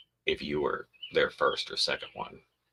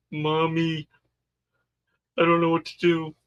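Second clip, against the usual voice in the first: high, childlike mock plea; helpless whiny boyfriend; 11 semitones higher, 5 dB louder than usual. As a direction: play the boyfriend as a helpless little kid.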